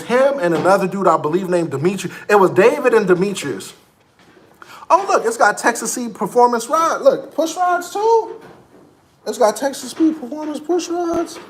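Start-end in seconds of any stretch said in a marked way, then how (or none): 4.9-11.4 high, eager admiring voice; suddenly pitched up in delight; excited car talk. Real, not acted. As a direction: gush over the push rods. Admiring car parts, voice jumps up.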